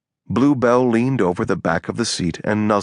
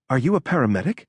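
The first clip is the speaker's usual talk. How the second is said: higher, abruptly put question; 5 semitones higher, faster than usual. A narrator's reading. Allie questioning the man doing compressions, abrupt. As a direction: ask it abruptly, pitch raised. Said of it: "Allie asked abruptly".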